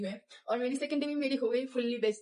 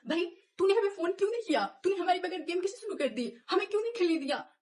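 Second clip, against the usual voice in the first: scolding rant; 7 semitones higher than usual. Retold or imitated. Junior girl scolding the tall sports coordinator, high angry rant. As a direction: tear into him, voice pitched up and sharp. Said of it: "मैंने उसे इतना लंबा चौड़ा से झाड़ा".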